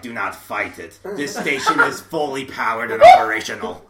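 the Emperor. Acted. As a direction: imitate an evil ruler commanding. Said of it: "the Emperor's just like".

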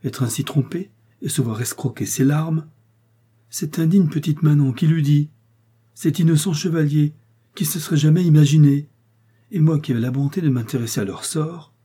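a wronged lady exclaiming in indignation; indignant outcry. A narrator's reading. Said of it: "s'écria madame".

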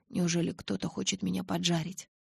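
usual speaking voice

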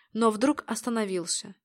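plain reading voice

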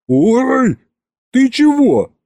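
high yelp of surprise, half-laughing; startled ouch. Acted. Husband yelping as his wife jabs his side, startled squeal. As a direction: jump at the poke and squeal.

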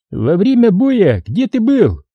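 high, drawn-out demand; high-pitched interrogating cry; shouted demand. A narrator's reading. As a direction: call out the question slowly, high-pitched. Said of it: "крикнул Гусейнов Мадаеву".

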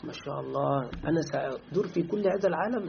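a naive student lost in daydreams; dazzled student. Retold or imitated. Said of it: "والطالب يسمع".

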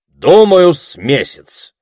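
loud start, slow settled finish; fairly confident. A narrator's reading. Orator estimating the campaign's length, fairly confident. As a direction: give the estimate with assurance. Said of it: "сказал оратор довольно уверенно".

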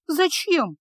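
high baffled echo; dumbly asking back. A narrator's reading. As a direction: repeat it blankly, confused. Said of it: "глупо переспросила девушка". (глупо переспросить, высоко)

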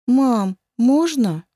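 put-on small girl asking sweetly; high little girl's plea; child voice. Дочка просит разрешения. (детский голосок)

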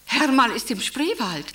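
ordinary delivery

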